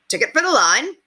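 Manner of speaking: high, loud street hawker voice; a wiry tout pitching his wares; sly ticket tout. Acted.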